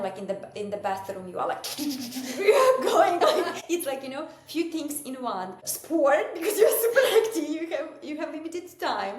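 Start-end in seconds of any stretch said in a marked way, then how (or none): 1.7-9.2 laughing. Real, not acted. Laughing, describing cleaning.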